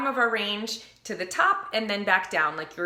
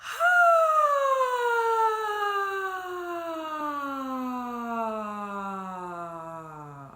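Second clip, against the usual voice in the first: yawning sigh; 7 semitones higher than usual. Shown as a demonstration. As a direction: yawn out a sigh from top to bottom. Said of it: "yawn and sigh out the air like this".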